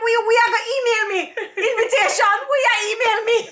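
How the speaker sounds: high, fast, laughing broken English; mock the scammer's broken English through laughter; laughing scammer accent. Mocking the scammer's email line, laughing high. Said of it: "I'm like".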